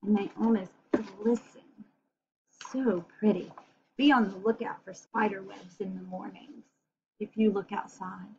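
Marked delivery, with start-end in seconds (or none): none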